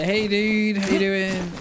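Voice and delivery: high bright hail to a stranger; 1 cheery greeting. Retold or imitated. Gamer hailing a stranger player in-game, high cheery greeting.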